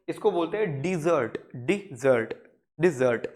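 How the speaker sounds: Desert with the weight on jot, de-ZERT; stress on second syllable. Shown as a demonstration. Teacher drilling the class: stress on jot. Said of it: "यहाँ पे stress दे रहे हैं हम".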